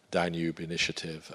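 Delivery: usual speaking voice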